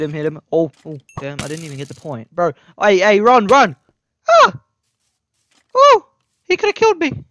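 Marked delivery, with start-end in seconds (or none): 2.8-7.2 high, loud shriek of panic; much higher terrified yell; panicked scream. Real, not acted. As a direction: scream as the skeleton hits. Skeleton attack panic.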